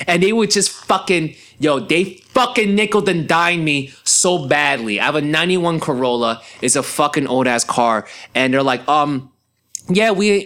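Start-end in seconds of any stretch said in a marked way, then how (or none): none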